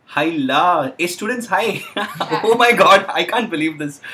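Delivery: laughing, high and loud delight; thrilled to find an audience, laughing; excited surprise. Real, not acted. Finds students watching live.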